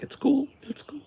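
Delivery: near-whispered, fading away; hushed reassurance. Retold or imitated. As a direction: imitate customers softly letting it slide. Customers quietly reassure the cook.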